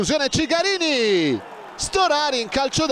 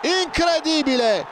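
goal commentary shout; 8 semitones higher than usual. Real, not acted. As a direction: cry out in disbelief. Keeper error gifts goal.

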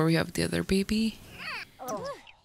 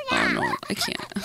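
high squeal breaking into laughter; overwhelmed high laughing squeal. Real, not acted. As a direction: squeal with delight, then laugh. Overwhelmed by cute sims.

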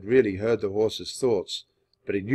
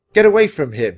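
loud, high command; raised voice, commanding prince; sharp order; 6 semitones higher, 13 dB louder than usual. A narrator's reading. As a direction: order the man off forcefully. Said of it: "he ordered the man".

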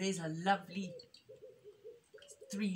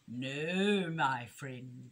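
low, drawn-out weasel voice; creepy storybook villain, half whispering; sinister murmur; 6 semitones lower, 4 dB louder, much slower than usual. A narrator's reading. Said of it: "she whispered".

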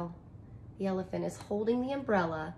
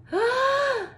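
gasp; 4 dB louder than usual. Acted. Reader gasps at the sign.

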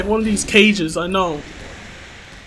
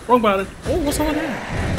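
ordinary delivery

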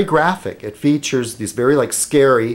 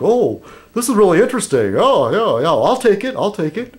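higher, gushing shop owner voice; camp shop owner; 8 semitones higher than usual. Retold or imitated. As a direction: play the delighted campy dealer. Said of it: "pretty, like, camp".

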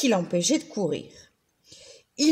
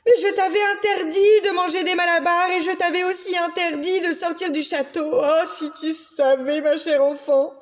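furious scolding; 6 semitones higher than usual. A narrator's reading. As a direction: tell off the runaway daughter. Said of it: "Furieux, le roi gronda".

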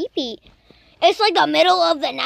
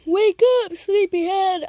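high, teasing wake-up call; sing-song wake-up call; 4 semitones higher than usual. Acted. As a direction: coax a sleepyhead awake. Waking the game character.

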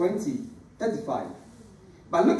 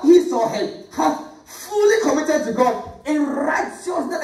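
raised-pitch earnest sermon; preaching exhortation; 7 semitones higher than usual. Acted. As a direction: urge the congregation to commit.

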